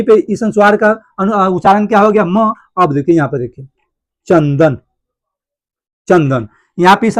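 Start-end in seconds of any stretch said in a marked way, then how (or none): 4.3-6.5 चंदन with the dot sounded as n; anusvara as n. Shown as a demonstration. Teacher giving students an example, चंदन with an n. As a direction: say चंदन, pausing between takes, with a clear n before द. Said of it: "अनुस्वार का उच्चारण यहाँ पे हो रहा है न".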